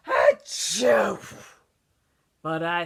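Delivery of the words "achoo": loud exploding achoo; big sneeze. Acted. Wolf sneezing the house down, loud fake sneeze. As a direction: let the sneeze burst out.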